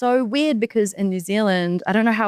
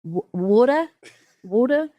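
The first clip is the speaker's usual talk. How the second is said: New Zealand accent; 4 semitones higher than usual. Shown as a demonstration. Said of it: "a big one ... ordering water".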